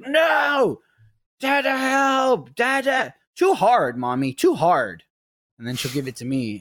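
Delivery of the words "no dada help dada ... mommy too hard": high, louder whiny shout; toddler's mock tantrum yell. Toddler daughter yelling at mom that it's too hard, high whiny shout. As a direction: wail it like a frustrated toddler. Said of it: "then she'll be like".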